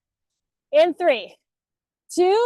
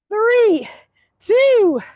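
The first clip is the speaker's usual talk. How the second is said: loud count; 7 semitones higher, 6 dB louder than usual. Real, not acted. Coach calling out final count.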